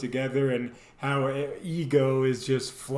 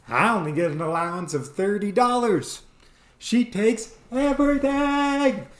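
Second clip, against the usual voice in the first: whiny mock complaint; 7 semitones higher, 4 dB louder than usual. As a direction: voice cracking high on the last part. Comedian voices the first guy.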